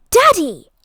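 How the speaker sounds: high, loud shriek at her father. A narrator's reading. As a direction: shriek it, scandalised. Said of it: "shrieked Daisy".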